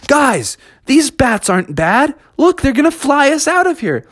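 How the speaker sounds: high, excited reassurance; eager. Acted. Slick tells the friends the bats will help, high and eager. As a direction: point out the good news eagerly.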